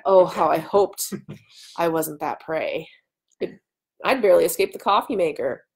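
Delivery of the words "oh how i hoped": usual speaking voice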